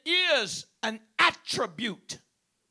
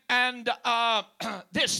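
ordinary talk, nothing marked

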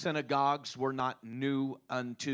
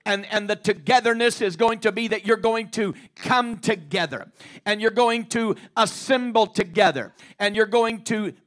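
rising, louder and louder sermon cadence; preaching at full force; 6 semitones higher, 9 dB louder than usual. Acted. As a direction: preach it out, building to a shout.

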